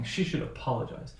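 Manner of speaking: "apologize" falls from its stressed syllable, said softly; soft statement with a fall on "apologize"; falling intonation. Shown as a demonstration. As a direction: let the tune drop on "apologize". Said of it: "That will be falling".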